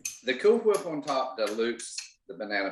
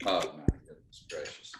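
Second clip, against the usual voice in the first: breathy awed whisper under the breath; 7 semitones lower, 6 dB quieter than usual. Real, not acted. Guest looking at the pudding, whispering in awe. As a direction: whisper it like the dish is too good to bear. Looking at tempting food on camera.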